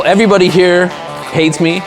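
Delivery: ordinary delivery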